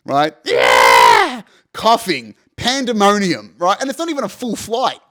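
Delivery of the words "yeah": put-on loud, low wailing baby scream; loud crying baby imitation; imitated baby scream. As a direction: mimic a baby screaming its lungs out. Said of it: "screaming baby".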